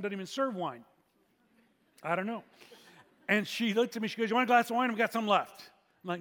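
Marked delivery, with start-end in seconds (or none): 4.3-5.7 mildly loud, higher-pitched offer. Retold or imitated. Woman loudly offering leftover wine to a diner, loud. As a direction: pitch it up, a bit too loud. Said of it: "she was being loud".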